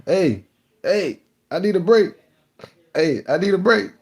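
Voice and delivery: high, pleading mock whine; whining coworker. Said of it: "he kept saying".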